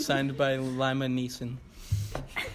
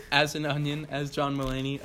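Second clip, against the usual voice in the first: ordinary delivery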